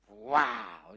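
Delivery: loud high cheer of wow; excited wow. Real, not acted. Pastor cheering his youth team with an excited wow. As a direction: shout it out like a crowd cheer.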